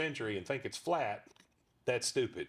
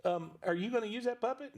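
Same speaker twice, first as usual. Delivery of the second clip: put-on young eager kid; 10 semitones higher than usual. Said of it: "I'm like".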